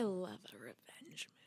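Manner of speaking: hushed, drawn-in whisper. Real, not acted. Confessing a love of revenge movies to co-host, whispered. Savouring her favourite film genre aloud.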